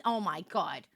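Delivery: low, soft groaning reaction; subdued disbelief. Real, not acted. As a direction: say it low, half to yourself. First, low muttered reaction.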